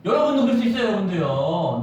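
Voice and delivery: usual speaking voice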